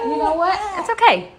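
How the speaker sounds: upbeat self-reassurance; cheerful. Real, not acted. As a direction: brush it off brightly. Shrugging off the flaws.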